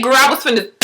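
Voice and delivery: ordinary talk, nothing marked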